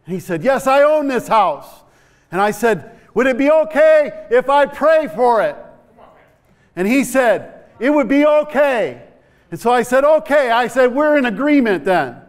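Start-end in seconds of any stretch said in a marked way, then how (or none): 0.4-1.7 high, loud and slow reply; loud answer. Retold or imitated. Old man answering the preacher, loud. 3.1-5.6 high, loud question; louder ask. Retold or imitated. Preacher asking the deaf man again, louder. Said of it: "So I spoke up a little louder". 7.8-12.2 high, loud back-and-forth; loud exchange. Retold or imitated. Preacher and old man talking loudly.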